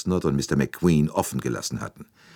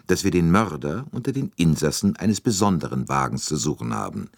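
ordinary delivery

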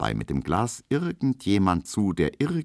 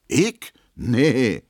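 indignant; 6 semitones higher, 5 dB louder than usual. A narrator's reading. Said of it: "sagt Emma empört".

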